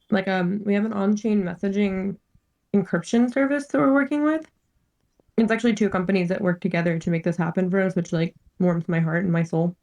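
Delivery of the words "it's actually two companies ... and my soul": ordinary talk, nothing marked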